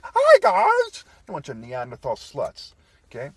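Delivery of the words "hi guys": much higher and louder, a squealed mock hello; mocking girly greeting. Mocking women in Europe with a much higher, louder girly hello. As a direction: lampoon a bubbly girl saying hi.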